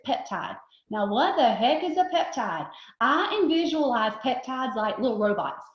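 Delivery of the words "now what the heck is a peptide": ordinary talk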